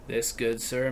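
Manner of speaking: hushed, grave, theatrical; mock gentleman. Mock duel declaration.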